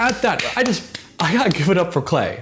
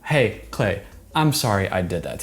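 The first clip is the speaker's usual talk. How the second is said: gently, meaning every word; sincere apology; 7 semitones lower, 3 dB quieter than usual. Shown as a demonstration. Showing the personal apology she could have given Clay, soft and sincere. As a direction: lower and softer, a personal sorry. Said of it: "It doesn't feel very personal".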